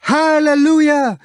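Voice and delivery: howled. A narrator's reading. High-pitched singer over clashing cymbals, howling. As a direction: howl it out at full pitch. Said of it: "no longer sang, but howled".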